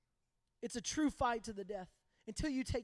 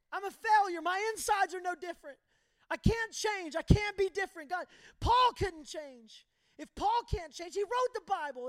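higher and louder bitter protest; frustrated outburst; 5 semitones higher, 5 dB louder than usual. Retold or imitated. Son snapping back at his dad, a higher, louder despairing protest. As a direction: throw the words back, despairing.

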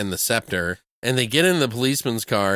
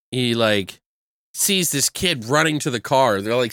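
normal talking voice

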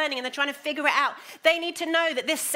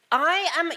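"I am" pitched higher and louder, pressed hard; emphatic "I am"; 6 semitones higher, 6 dB louder than usual. Shown as a demonstration. Preacher re-reading Paul's words, stressing "I am". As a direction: lean hard on "I am". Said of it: "said it with that emphasis".

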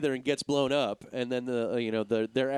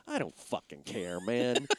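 flat bored mutter; 6 semitones lower, 6 dB quieter than usual. Retold or imitated. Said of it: "I'm just like".

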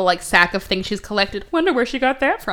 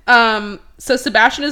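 usual speaking voice

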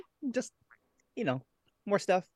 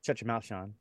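soft, low robotic assistant voice; lightly voiced Zoom lady; man doing a woman's voice; 5 semitones lower, 6 dB quieter than usual. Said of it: "the Zoom lady voice going".

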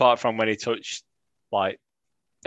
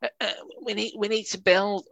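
softer, whiny cautious moaning; 3 semitones higher, 4 dB quieter than usual. Retold or imitated. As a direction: moan it like a nervous fan. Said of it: "This is just my whiny voice".

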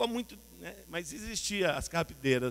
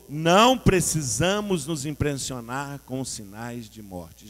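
louder, slow and emphatic; said again louder; 2 semitones lower, 9 dB louder, much slower than usual. Shown as a demonstration. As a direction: declare it stronger the second time. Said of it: "De novo".

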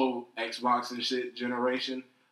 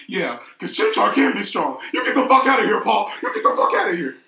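high, loud mock yelling; indignant outburst; 10 semitones higher, 12 dB louder than usual. Gamer retelling how he told off Paul, indignant yelling. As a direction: yell it like you're chasing him off.

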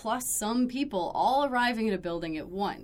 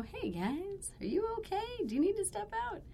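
soft, higher caring check-in; gentle check-in; 11 semitones higher, 7 dB quieter than usual. Retold or imitated. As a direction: softly ask if they're okay. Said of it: "pop my head in and go".

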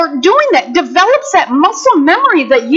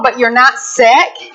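normal talking voice

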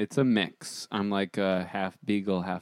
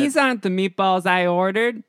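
loud, high put-on little voice; childlike high pitch; 10 semitones higher, 7 dB louder than usual. Actor asked for more childlike read, high loud voice. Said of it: "a little more childlike".

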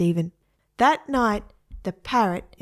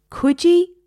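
emphatic call; 10 semitones higher, 7 dB louder than usual. A narrator's reading. Stephen drilling the parrot.

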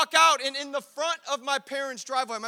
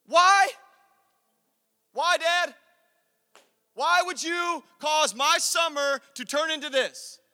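loud, higher pleading why; mock protest; 4 semitones higher, 5 dB louder than usual. Son protests his ruined summer to dad, loud and pleading.

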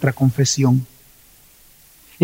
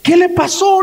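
much higher startled question; a guard shocked at what he sees; high alarmed "¿qué le pasó?"; 12 semitones higher than usual. Retold or imitated. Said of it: "le dice".

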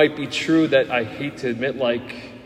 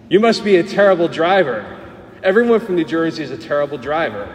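mock teasing; 4 semitones higher, 5 dB louder, faster than usual. Students mocking Jersey drivers.

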